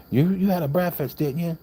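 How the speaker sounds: high, bright mock waitress asking across the counter. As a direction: pitch it way up like the busy waitress. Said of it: "She's like".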